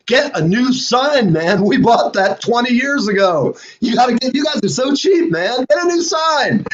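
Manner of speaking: high-pitched, exasperated ribbing; teasing, high-pitched scolding; mock harangue. Mock-harangue shout-out to friends.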